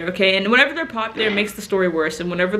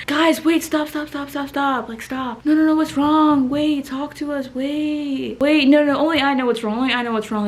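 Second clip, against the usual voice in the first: mock dramatic; 5 semitones higher than usual. Mock dramatic concern to class.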